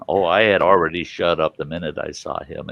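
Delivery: plain reading voice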